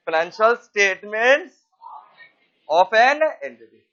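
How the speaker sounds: each word stretched for notes; very slow dictation. Shown as a demonstration. Teacher dictating the definition, very slow. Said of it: "इतना fast बोल रहे हो".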